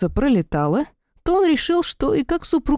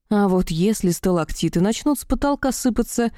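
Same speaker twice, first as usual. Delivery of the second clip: usual speaking voice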